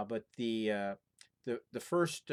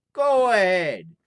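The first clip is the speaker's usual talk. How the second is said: loud, higher, drawn-out careless shout; bold slow shout; 9 semitones higher, 15 dB louder, much slower than usual. Acted. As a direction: call up the chimney without fear, slowly. Fearless lad shouts up chimney.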